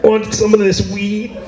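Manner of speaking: high, laid-back stoner offer, slow on the weed line; stoner dude. Retold or imitated. Cool uncle Canada offering weed to a nephew, stoner drawl. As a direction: pass the joint like a mellow stoner buddy. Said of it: "lets out a little cough".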